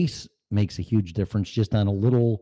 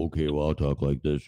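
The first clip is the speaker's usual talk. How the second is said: much lower, softer put-on voice; forced deep voice; 7 semitones lower, 4 dB quieter than usual. Mocking beginners faking a low voice, forced deep. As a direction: push the voice down, fake deep. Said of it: "they'll practice and go".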